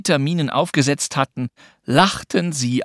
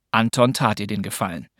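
ordinary delivery

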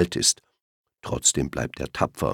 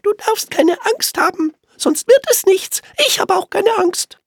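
sehr hohe, laute, etwas herablassende Rehstimme; hilfsbereit, aber von oben herab; leicht hochnäsig; 5 dB louder than usual. Acted. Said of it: "ein kleines bisschen hochnäsig". (ein bisschen hochnäsig, sehr hoch und laut)

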